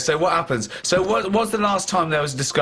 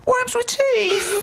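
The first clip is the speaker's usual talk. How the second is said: slow, much higher mock-dramatic voice; mock announcement; much slower than usual. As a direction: stretch it out like a grand reveal.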